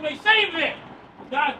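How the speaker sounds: ordinary talk, nothing marked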